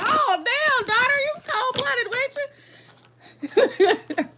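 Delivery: a touch of a shocked mom; teasing mock awe. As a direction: play it amazed and teasing. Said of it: "I'm like".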